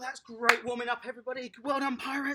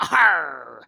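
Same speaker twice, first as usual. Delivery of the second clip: loud, low pirate Arr; pirate growl; 9 semitones lower, 16 dB louder than usual. Acted. Coach playing pirate to the children, a loud low growl. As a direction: bellow it like a hammy pirate. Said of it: "today we're gonna be a pirate".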